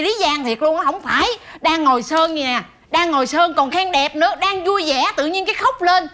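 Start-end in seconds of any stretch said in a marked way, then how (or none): none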